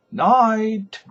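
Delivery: high, loud call from the car; a carter's parting call; called goodnight. Acted. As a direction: call it across the street. Corny calls goodnight from car.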